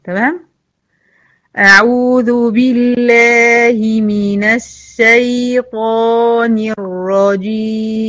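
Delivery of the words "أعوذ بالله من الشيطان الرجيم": slow, drawn-out chanted recitation; slow Quran recitation. Shown as a demonstration. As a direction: recite it in measured tajweed. تلاوة الاستعاذة ببطء. (تلاوة مجودة بطيئة)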